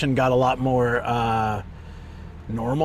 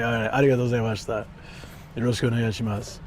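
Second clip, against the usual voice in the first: lower, fast polite Japanese; 3 semitones lower, 3 dB quieter, much faster than usual. Retold or imitated. Streamer showing his lowered Japanese voice, quick. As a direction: pull the Japanese down low. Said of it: "I had to lower it manually".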